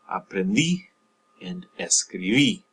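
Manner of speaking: aprendí and escribí, each stressed on the last í; final-syllable stress. Shown as a demonstration. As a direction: push down on the endings of aprendí and escribí. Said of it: "stress on that last syllable".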